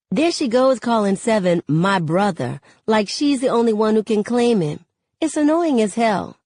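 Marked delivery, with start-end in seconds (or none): none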